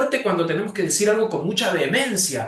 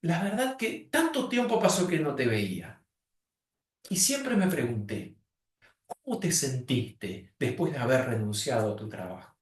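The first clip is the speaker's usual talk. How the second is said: soft, lower, caring talk; tender catching up; 5 semitones lower, 6 dB quieter than usual. Shown as a demonstration. Coach showing talk with a loved friend, tender and soft. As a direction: speak as to a dear friend, hushed. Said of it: "charlar con alguien a quien queremos mucho".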